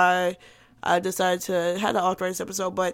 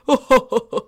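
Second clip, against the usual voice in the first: put-on snooty older man's chuckle, louder; older rich laugh; 6 dB louder than usual. Imitating an older rich man, haughty chuckle. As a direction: laugh like a rich old guy. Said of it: "the older guy, rich laugh".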